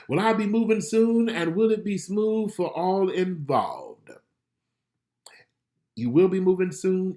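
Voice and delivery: reading a question. Acted. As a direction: announce the question and answer. Reads viewer question and answer.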